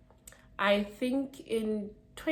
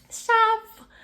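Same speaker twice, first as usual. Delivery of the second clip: much higher and loud; excited; 11 dB louder than usual. Real, not acted. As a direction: squeal it out. Excited exclamation.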